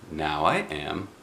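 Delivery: low, soft bear grumble; a hint of a low growly bear. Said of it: "said a growly voice".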